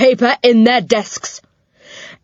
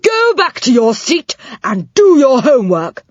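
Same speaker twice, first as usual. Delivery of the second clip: ordering; 7 semitones higher, 5 dB louder than usual. A narrator's reading. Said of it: "ordered the old dame".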